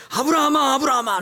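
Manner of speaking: loud, high cry of a name; urgent calling. Acted. God stopping Abraham at the altar, urgent shout. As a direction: shout it urgently to stop someone. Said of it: "이렇게 막 급하게 불러요".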